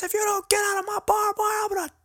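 imitating a drill sergeant's very high, strained yell; mocking, high-pitched drill instructor tirade. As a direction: bark at the recruit like an angry drill instructor. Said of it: "He says".

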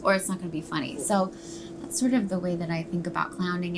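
usual speaking voice

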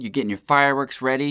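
normal talking voice

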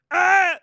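loud, very high mock yelp; imitated high squawk. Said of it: "And she was like".

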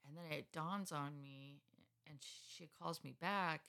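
ordinary talk

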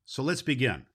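ordinary delivery

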